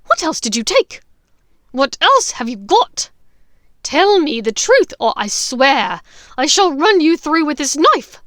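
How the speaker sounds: loud, high threatening voice; threatening rage. Acted. Bellatrix threatening Hermione with a dagger. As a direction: threaten her with the knife.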